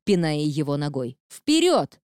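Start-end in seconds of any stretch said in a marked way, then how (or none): 1.3-2.0 sharp high shout. A narrator's reading. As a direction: yell the order at him. Cop kicking and taunting suspect.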